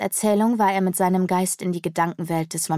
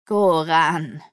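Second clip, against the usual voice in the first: croaking; 3 dB louder than usual. A narrator's reading. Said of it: "krächzte die Fledermaus".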